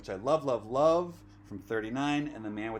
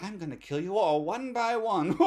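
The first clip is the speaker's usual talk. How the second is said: high, hammed-up villain threat with a cackle; gloat, then burst into an evil laugh; mock movie-villain menace; 9 semitones higher, 6 dB louder than usual.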